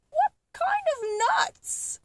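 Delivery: high-pitched, squeaky monkey cry; a small monkey hardly daring to hope; excited monkey. Acted. Monkey asking the Duke about the nuts, squeaky and high. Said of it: "cried the monkey".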